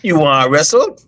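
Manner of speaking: put-on high, disbelieving Guyanese accent; a Guyanese parent in disbelief. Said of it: "in the Guyanese accent".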